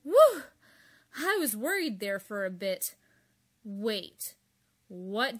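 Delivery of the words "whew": loud exhale of relief; relieved whew. Acted. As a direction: blow out relief. Relief after worry.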